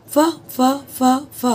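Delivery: फ repeated as a crisp f sound. Shown as a demonstration. Teacher isolating the फ sound for students. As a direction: sound out फ on its own, again and again. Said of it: "तो क्या आ गया alliteration".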